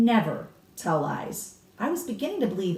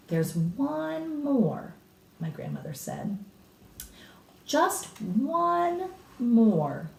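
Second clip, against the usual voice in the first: suspenseful hint; 6 semitones higher, much slower than usual. A narrator's reading. Grandmother builds suspense.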